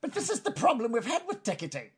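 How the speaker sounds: high goblin grumble; complaining goblin. Acted. Goblin complaining about ticketing, high-pitched voice.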